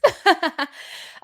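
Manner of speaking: high burst of laughter; high-pitched laughing fit. Real, not acted. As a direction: laugh it off in a high, rapid giggle. Laughs at own pronunciation fumble.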